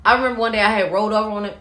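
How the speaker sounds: ordinary talk, nothing marked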